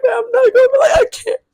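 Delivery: high, loud teary whine; almost crying as she rants; retold near tears. Ranting to her boyfriend on the phone: high teary whine. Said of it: "I'm on the verge of tears".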